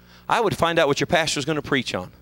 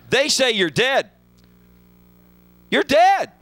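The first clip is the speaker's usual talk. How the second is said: climbs higher and louder, peaking on dead; rising mock alarm; 6 semitones higher, 4 dB louder, slower than usual. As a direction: build it up to a higher, louder cry. Mocking the doom-sayers.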